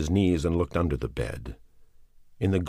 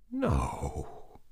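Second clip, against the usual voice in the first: voice breaking; 6 dB quieter than usual. A narrator's reading. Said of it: "his voice breaking".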